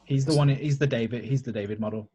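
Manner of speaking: normal talking voice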